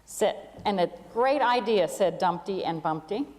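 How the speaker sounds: put-on gentle storybook reading for children; story narration. A narrator's reading. Pastor reading a camel story to the congregation, storybook narration. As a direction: tell it like a bedtime tale to the whole room.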